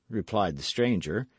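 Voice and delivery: usual speaking voice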